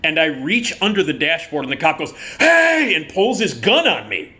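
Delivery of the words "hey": high, loud cop's yell; retold sudden warning shout; alarmed shout. Cop pulls gun on reaching man, sharp loud shout.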